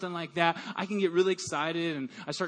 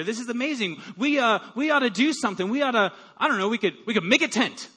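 excited rambling; 4 semitones higher than usual. Retold or imitated. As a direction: gush like an overexcited fan. Excited Peter rambling at transfiguration.

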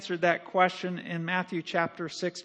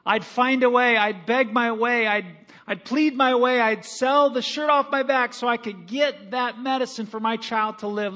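louder, driving preacher's build; preaching fervour; 3 semitones higher, 5 dB louder than usual. Acted. Pastor rousing his congregation, loud preaching build. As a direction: preach it like a father who'd do anything.

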